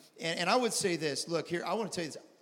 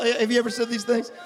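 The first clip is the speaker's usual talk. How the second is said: preaching at full force; 8 semitones higher, 8 dB louder than usual. Acted.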